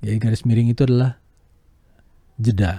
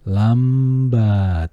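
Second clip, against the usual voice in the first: lambat drawn out slowly; slowed on lambat; slow demo; 4 dB louder than usual. Shown as a demonstration. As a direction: stretch lambat. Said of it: "garis-garis bawah".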